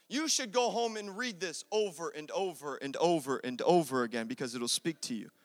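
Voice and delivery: normal talking voice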